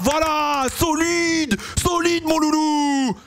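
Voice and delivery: high, slow delighted cheer; excited squeal. Real, not acted. Streamer cheers his driver at the start, high delighted squeal. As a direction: squeal with joy as the car holds on.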